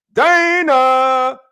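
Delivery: long high holler of the name; calling out. Real, not acted. Host spots Dana joining, long high shout. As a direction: holler it out and hold it. Host calls out arriving viewer.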